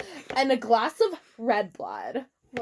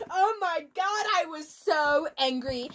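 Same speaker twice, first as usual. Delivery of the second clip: ordinary delivery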